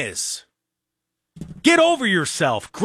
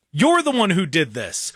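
ordinary talk, nothing marked

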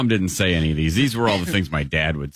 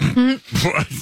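high startled what; reeling at the crude line; high shocked outburst. Real, not acted. Shocked at graphic quote.

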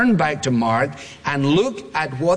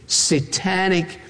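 normal talking voice

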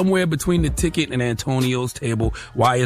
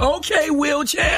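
high, drawn-out teasing nickname; casual nickname callout; 12 semitones higher, slower than usual. Acted. Showing how friends label each other, high teasing callout. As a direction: call out a nickname, pitched way up. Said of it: "this is how we talk".

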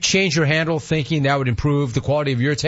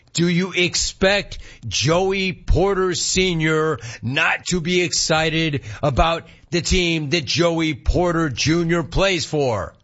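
ordinary talk

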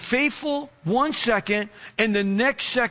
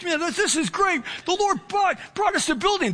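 pitched up high with joy; reliving the thrill of the new building; retold rejoicing; 7 semitones higher than usual. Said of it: "So then I'm rejoicing".

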